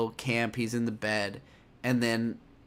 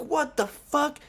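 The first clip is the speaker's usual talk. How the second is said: shocked soldier; 13 semitones higher, slower than usual. Retold or imitated. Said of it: "The other guy's like".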